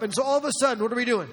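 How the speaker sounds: ordinary delivery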